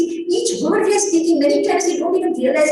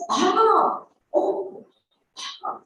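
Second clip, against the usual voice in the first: sigh of realization; 6 semitones higher, 3 dB quieter, much slower than usual. Shown as a demonstration. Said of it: "we get what is called the ah".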